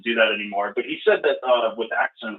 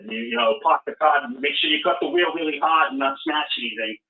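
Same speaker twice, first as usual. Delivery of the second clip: thick Rhode Island accent, dropped r's. Shown as a demonstration. Showing off how they talk back home, in a Rhode Island accent. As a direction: talk like a Rhode Islander among old friends. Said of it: "You'll know I'm from Rhode Island from that".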